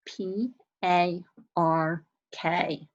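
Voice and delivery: letters of "park" spaced out slowly; slow spelling. Shown as a demonstration. Teacher slowly spells the word for young students. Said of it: "how did you spell park".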